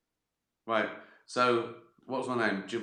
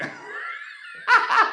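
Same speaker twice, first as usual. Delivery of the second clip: very high breathy laugh shout; shrieking laugh; 5 dB louder than usual. Real, not acted. He shrieks with laughter at his own joke.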